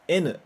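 "N" said the Japanese way, as "enu"; "N" pronounced "enu", not English "en"; Japanese letter N. Shown as a demonstration. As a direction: model "N" as "enu" for students to echo. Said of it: "repete depois de mim".

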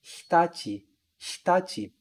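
"Hitachi" with the i nearly mute; devoiced i. Shown as a demonstration. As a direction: whisper away the i of "Hitachi". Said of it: "Eu falo assim, ó".

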